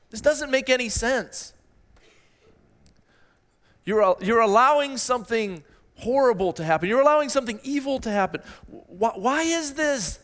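high-pitched, bewildered pleading, acted out; crying out to God. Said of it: "as he cries out to God".

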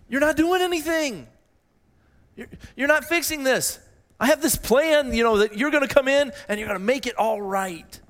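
very high-pitched protest, acted out; calling out to God. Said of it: "he was calling out to God saying".

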